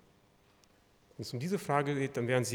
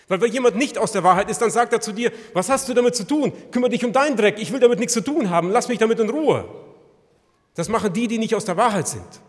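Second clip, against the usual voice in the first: loud, high, fervent sermon voice; preaching at full force; 7 semitones higher, 10 dB louder, faster than usual. Acted. Preacher warns the congregation, loud and fervent. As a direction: drive the warning home to the congregation.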